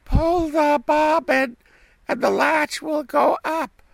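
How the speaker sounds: put-on very high, loud old woman call; old granny calling out. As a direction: grandmother calling from bed. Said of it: "cried out".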